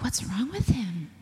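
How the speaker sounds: hushed whisper to herself; whispered aside. Retold or imitated. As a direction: whisper it in alarmed disbelief. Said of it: "And I was like".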